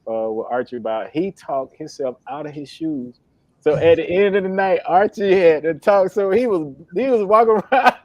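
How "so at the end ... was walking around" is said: louder, higher, laughing through it; can't hold in the laughter as the story lands. Real, not acted. Telling joke, cracking up.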